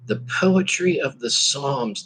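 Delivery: normal talking voice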